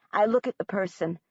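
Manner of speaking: plain reading voice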